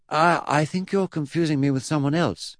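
put-on high, stammered boy's objection; hesitant protest. Eddie corrects his great-uncle, high hesitant protest. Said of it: "Eddie protested".

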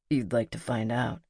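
"You'd like to find out" as a low, certain statement; statement, not question. A narrator's reading. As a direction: let "find out" settle downward, no asking. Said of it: "It's a statement, not a question".

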